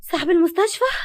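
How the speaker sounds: gasp, then high, loud question; stunned disbelief with rising ending; shocked. A narrator's reading. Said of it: "وقالت بصدمة".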